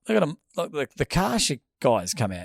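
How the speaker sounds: usual speaking voice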